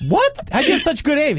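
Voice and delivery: high incredulous questions; hammed-up surprise; mock astonished. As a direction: act amazed at his aim. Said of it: "he'd be like".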